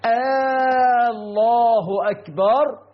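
آه الله أكبر with the first vowel dragged into a long questioning aah; interrogative hamza mistake. Shown as a demonstration. Preacher showing worshippers a common takbir mistake, stretched opening vowel. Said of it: "فيقول هكذا".

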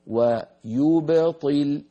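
"ويبطل" ببطء شديد مع باء مقلقلة; مطّ الكلمة وأبرز الباء الساكنة; قلقلة الباء. Shown as a demonstration. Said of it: "انظروا لكلمة ويبطل". ("ويبطل" بباء ساكنة مقلقلة واضحة)

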